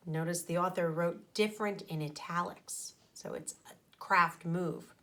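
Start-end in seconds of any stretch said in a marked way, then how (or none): none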